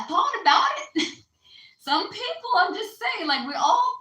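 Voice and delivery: real laughter over a naughty joke; laughing. Real, not acted. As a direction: giggle it out like a cheeky confession. Joking about felonies.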